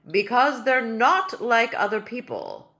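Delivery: loud, slow and stately; a small royal full of his own dignity; pompous tiny king. Acted. Tiny king defending his babies to Ellie, loud and stately.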